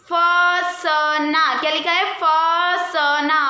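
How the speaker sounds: फसना stretched very slowly, nasal अ held; drawn-out demo word. Shown as a demonstration. Teacher to class, modelling the nasal, slow फसना. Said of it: "फ के बाद अ का pronounce".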